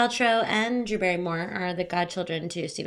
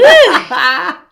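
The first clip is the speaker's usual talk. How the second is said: high laughing squeal; excited; 9 semitones higher, 14 dB louder than usual. Real, not acted. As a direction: squeal with delight.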